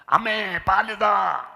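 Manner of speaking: put-on high, slow babyish voice; imitating a baby. Pastor mimicking a newborn asking its mother for milk, high and slow. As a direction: play a tiny baby asking mum for milk. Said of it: "എന്ന് പറഞ്ഞ് കരയുമോ".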